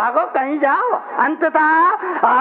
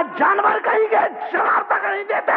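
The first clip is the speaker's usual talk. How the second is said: a shouted, high-pitched jibe at the husband; angry wife; 9 semitones higher than usual. Acted. Wife yells at her silent husband, furious shout. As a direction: let her snap it out, furious.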